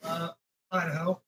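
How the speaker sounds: faint far-off voice down the line; quiet voice loud hiss. Real, not acted. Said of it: "Oh, you're super quiet".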